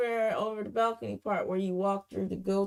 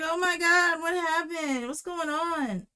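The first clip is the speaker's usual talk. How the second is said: high-pitched imitation of worry; worried retold quote, drawn out at the end; concerned; 7 semitones higher than usual. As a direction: act out a friend's alarmed concern. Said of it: "She's over there concerned".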